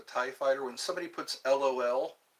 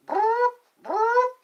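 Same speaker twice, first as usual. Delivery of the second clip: fake burp voice; 8 dB louder than usual. Fake burping noises.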